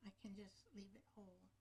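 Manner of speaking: ordinary talk, nothing marked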